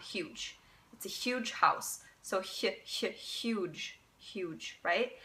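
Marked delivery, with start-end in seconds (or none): 0.0-0.6 huge breathed with a hissing h; soft hissed huge; whispered huge. Shown as a demonstration. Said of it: "kind of like that sound". 2.4-4.8 hissed soft ch then huge; soft German ch. Shown as a demonstration. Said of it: "This is the C H sound".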